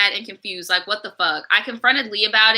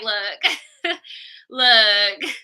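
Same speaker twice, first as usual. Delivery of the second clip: laughing look. Real, not acted. Laughing at the situation.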